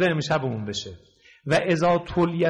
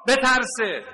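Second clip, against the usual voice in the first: raised warning; 7 semitones higher, 4 dB louder than usual. Real, not acted. Preacher urges warning listeners.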